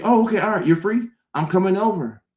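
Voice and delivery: high, eager voice of a friend inviting themselves over; pushy friend. Retold or imitated. Friend pushing to come over when you're free, high and eager. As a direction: chirp it like you've already grabbed your keys.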